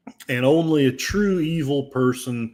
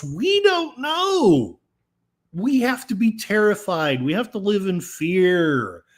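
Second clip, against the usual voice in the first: mock terror; 6 semitones higher than usual. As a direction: pretend to be terrified, sarcastically. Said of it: "are said in sarcasm".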